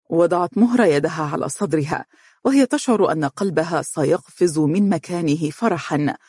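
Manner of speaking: plain reading voice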